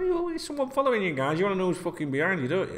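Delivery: high, teasing creepy sing-song; mock stalker voice. Teasing his followers in a mock creepy voice.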